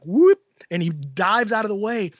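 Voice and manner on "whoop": dodging whoop. Retold or imitated. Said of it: "he's like".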